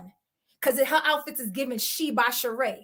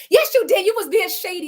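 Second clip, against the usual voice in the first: louder, higher insistence; insistent; 7 semitones higher, 5 dB louder than usual. Retold or imitated. Cast insisting to Cornelius, loud and high. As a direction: insist over him.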